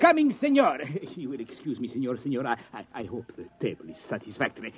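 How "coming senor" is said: much higher, hurried call back; hotel owner scrambling to answer; flustered reply. Acted. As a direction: sing out anxiously.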